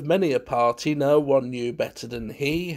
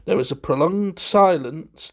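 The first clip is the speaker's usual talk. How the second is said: ordinary delivery, nothing marked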